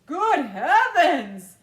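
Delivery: slow, very loud shocked shout; father's shout. A narrator's reading. Father shouting as his son disappears, very loud and slow. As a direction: shout it out in disbelief. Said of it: "shouted Mister T V".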